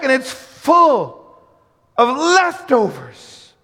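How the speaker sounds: high, loud, shouted sermon climax; full-force preaching. Real, not acted. Preacher telling how the whole crew gets fed, full-force shouting. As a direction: preach it at the top of your voice.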